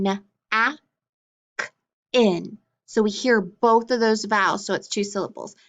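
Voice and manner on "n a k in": "na, a, k, in" as separate sounds with clear pauses between; sounded out. Shown as a demonstration. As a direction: sound out napkin bit by bit: na, a, k, in. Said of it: "we hear each vowel. Listen".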